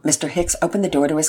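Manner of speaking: ordinary delivery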